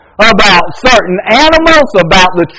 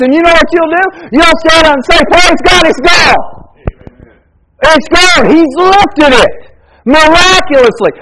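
much higher, shouted praise; full-force preaching; 8 semitones higher than usual. Acted. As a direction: cry out the victory to the church.

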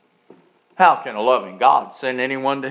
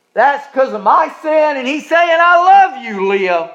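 higher and louder, fervent preaching; impassioned preaching; 6 semitones higher, 8 dB louder than usual. Acted. Preacher dramatizing the cross for the church, fervent and loud. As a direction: build it with rising passion.

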